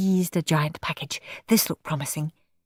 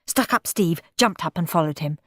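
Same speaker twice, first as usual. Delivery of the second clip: ordinary delivery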